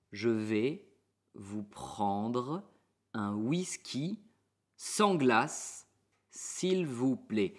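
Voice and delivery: slow, pausing between word groups; slow chunked. Shown as a demonstration. Teacher slows the order down so students can repeat. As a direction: spell it out phrase by phrase.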